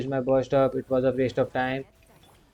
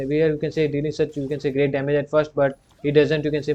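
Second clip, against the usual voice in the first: ordinary delivery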